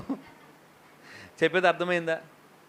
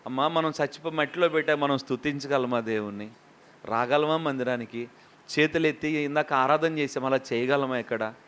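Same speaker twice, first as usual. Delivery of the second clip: ordinary delivery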